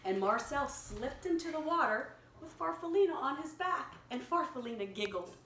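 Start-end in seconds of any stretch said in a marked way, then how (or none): none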